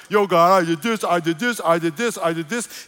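loud, cocky bragging to God; boastful plea. Pretend. Believer bragging to God at judgment, cocky. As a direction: list your good deeds proudly.

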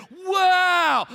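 big, loud, high, stretched-out cry; amazed audience imitation. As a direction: mimic the crowd's awestruck reaction. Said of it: "just like you guys did".

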